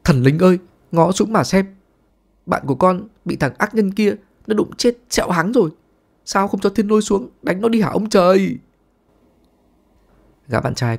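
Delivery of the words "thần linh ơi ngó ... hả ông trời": high, hammed-up cry to the sky; mock wailing to heaven. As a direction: howl at the heavens like a tragic actor. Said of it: "giơ hai tay lên trời mà gào thét".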